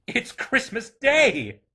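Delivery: high, loud, drawn-out delight; thrilled discovery; exclaiming to himself. A narrator's reading. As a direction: exclaim in joyful realisation. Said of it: "said Scrooge to himself".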